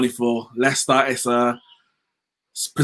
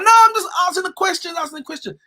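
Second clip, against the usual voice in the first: very high-pitched mock voice; mocking imitation; faster than usual. Host mocking a man dodging blame, very high mimicking voice. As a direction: mimic him in a squeaky, exaggerated falsetto. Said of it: "he says something and he's like".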